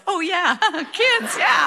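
dad's sheepish laughing shout from the car; laughing mock yell. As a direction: yell it like you just remembered the kids. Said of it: "And my dad yells".

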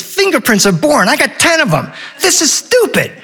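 put-on high-pitched, indignant child; whining kid. Henry the son griping about the whale sighting, high whine. Bored boy complains again.

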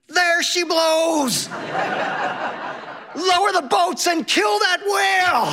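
put-on kid screaming. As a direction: bellow it like a thrilled boy whaler. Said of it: "And Henry screams".

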